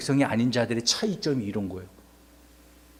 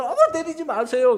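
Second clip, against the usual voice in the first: mock frightened plea; 10 semitones higher than usual. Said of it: "어떤 친구는 무서워서".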